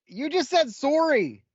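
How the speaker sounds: high, drawn-out retort; sing-song high, slow comeback; mock incredulous retort. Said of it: "I'm like".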